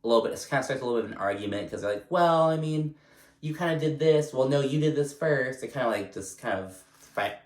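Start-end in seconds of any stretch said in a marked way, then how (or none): 2.1-5.6 higher-pitched mock squabble; imitated bickering. Housewives arguing over who started it, imitated bickering. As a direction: act out both sides of a petty argument.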